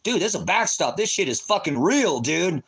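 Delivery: a light impression of a guy named Bob; Bob's voice. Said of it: "Bob's up front going".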